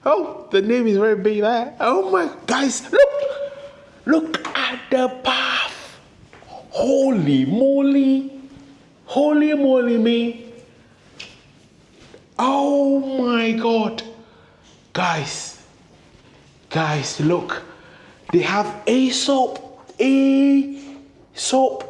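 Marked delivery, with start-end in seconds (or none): 0.1-14.0 real excitement. Real, not acted. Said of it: "I'm just so excited". 18.3-21.8 high, thrilled squeal; real excitement. Real, not acted. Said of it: "I'm just so excited".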